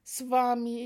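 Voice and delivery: lauter Hilferuf nach Swami, verzweifelt; laut rufen, den Namen hinausgerufen. Retold or imitated. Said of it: "rief ich laut". (lauter verzweifelter Ruf)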